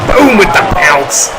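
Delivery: high shouted move call; excited shout. Real, not acted. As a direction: punch out the big impact. Calling a big impact move.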